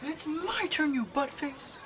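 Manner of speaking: put-on very high, loud, whiny kid; bratty child. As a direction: kid yelling to keep the toy. Children quarrel over toy.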